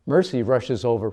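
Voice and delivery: ordinary delivery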